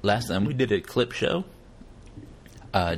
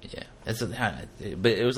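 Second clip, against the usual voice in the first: usual speaking voice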